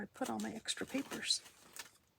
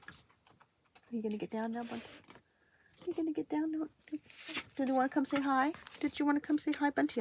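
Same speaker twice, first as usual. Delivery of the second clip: pet talk; 6 semitones higher than usual. Real, not acted. Talking to cat Bunty.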